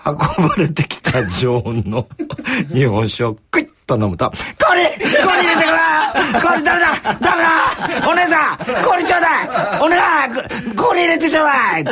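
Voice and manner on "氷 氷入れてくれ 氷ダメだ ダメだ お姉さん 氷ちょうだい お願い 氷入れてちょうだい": much higher, louder panicky yelling, laughing through it; old man shrieking at the waitress for ice; shrill loud shout. Retold or imitated. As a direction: squeal for ice like a desperate old drunk. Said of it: "また甲高い大声で叫ぶのです".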